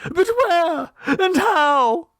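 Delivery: very high, frantic mother's question; distracted mother. A narrator's reading. A distraught mother asks Clovis where the baby went, very high. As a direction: beg to know where, high and lost. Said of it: "the distracted mother".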